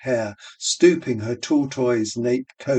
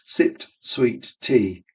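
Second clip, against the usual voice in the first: usual speaking voice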